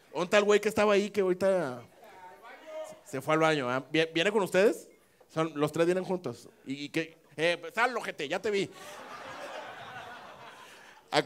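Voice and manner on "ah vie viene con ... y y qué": ordinary talk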